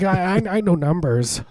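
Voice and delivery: high stammering doll voice; lightly voiced talking doll; pull string toy. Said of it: "it's like".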